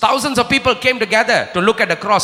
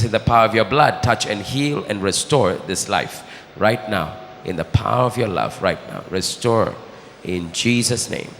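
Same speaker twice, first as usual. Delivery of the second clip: healing prayer; 13 semitones lower, 3 dB quieter than usual. Acted. Preacher praying quietly over the sick for healing.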